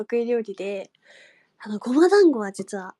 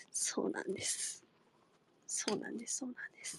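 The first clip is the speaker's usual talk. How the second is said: whispered, softer and softer; fading whisper; 17 dB quieter than usual. Real, not acted. Repeating to viewers, whisper fading out. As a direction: let it die away to nothing.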